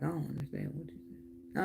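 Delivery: quiet confused aside. Retold or imitated. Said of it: "I'm like".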